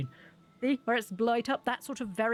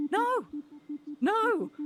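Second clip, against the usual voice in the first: incredulous denial; 9 semitones higher than usual. Real, not acted. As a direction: pitch each one way up, amazed. Exasperated answer about getting sick.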